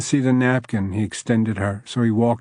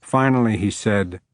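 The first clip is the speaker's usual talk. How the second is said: ordinary delivery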